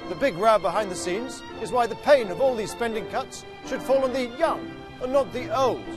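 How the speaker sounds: ordinary talk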